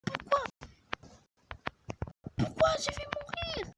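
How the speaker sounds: very high, desperate pleading cries; panicked high wail. Real, not acted. Girl panicking as her shark is about to die, high desperate wail. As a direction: wail it like the end is coming.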